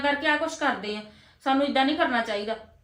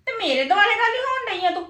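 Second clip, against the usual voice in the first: higher-pitched indignant comeback; indignant retort; 6 semitones higher than usual. Acted. Elder sister-in-law objects to being blamed, indignant. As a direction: answer back, affronted, with the pitch raised.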